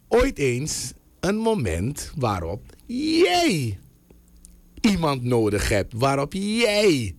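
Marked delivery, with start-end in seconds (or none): 2.2-3.8 JIJ stretched long with a falling tune; drawn-out emphasis. Real, not acted. Dramatic build to the listener's crisis. 6.0-7.1 slow, higher JIJ, falling away; drawn-out emphasis. Real, not acted. Repeats dramatic build, addressing listener.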